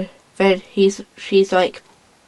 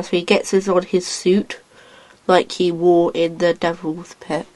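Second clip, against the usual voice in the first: normal talking voice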